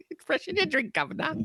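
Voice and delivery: high, hammed-up Cockney quote; mock British accent. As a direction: camp it up like a Simpsons Brit. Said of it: "make fun of your accent".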